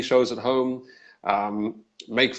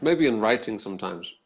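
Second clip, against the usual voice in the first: ordinary talk, nothing marked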